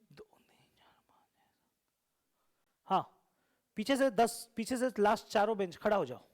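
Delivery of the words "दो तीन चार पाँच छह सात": counting that fades into a whisper. Real, not acted. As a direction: count it down to nothing, breathless. (धीमी होती फुसफुसाती गिनती)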